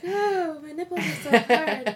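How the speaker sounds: mock complaint. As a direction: whine playfully through a laugh.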